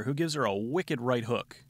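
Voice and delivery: usual speaking voice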